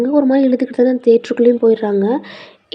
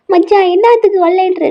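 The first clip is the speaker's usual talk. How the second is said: much higher, louder outraged question; loud protest; 8 semitones higher, 5 dB louder than usual. Acted. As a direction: demand why he won't come. Friend protests refusal. (உரத்த எதிர்ப்புக் கேள்வி)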